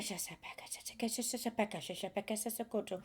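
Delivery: breathy unvoiced syllables, very soft; whispered light language. Channeler whispering light language to listeners, hushed.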